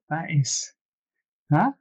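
normal talking voice

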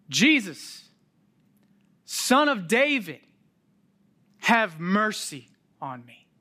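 loud, high plea; beggar crying out. Retold or imitated. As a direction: cry out to him for mercy. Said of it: "as he cries out".

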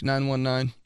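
normal talking voice

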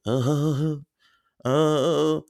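high imitated moans; mock moaning. Roommate heard in the dark: imitated high moans.

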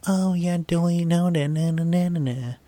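high prissy singsong brush-off; mock prim. S0 mocking Sansa acting like Littlefinger, prissy singsong. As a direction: wave it off like a smug courtier. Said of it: "being a little Littlefinger".

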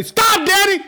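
high, loud "Stop, Daddy"; Jesus stopping the preacher, loud and high; exclaimed stop. Retold or imitated. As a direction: call out to halt everything. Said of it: "Jesus is gonna say".

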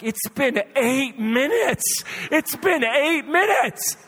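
high, loud, slow mock outrage; appalled disbelief. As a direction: stretch each word in stunned protest. Husband appalled at wife's haste.

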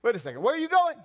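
high, loud startled Peter; blurting Peter. Acted. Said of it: "Peter's like".

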